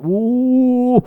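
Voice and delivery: long, high wailed "uh"; hammed-up high wail; mock shriek. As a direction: let out a drawn-out cry of horror. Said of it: "sampai dia ngejerit".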